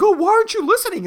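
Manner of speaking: fast, loud mock complaint; mad old person. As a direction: imitate an annoyed scolding, high and loud. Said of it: "And then they get mad".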